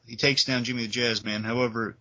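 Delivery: normal talking voice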